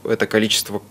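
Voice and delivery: normal talking voice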